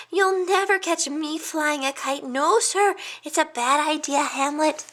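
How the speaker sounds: a nervous little hedgehog listing past disasters; fretful little hedgehog. A narrator's reading. Hedgehog friend warning the pig about his decisions, high fretful voice.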